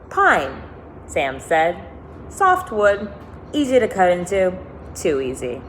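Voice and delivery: lively read-aloud narration; expressive storybook reading with lifted quotes; story reading. A narrator's reading. Reads novel aloud to listeners.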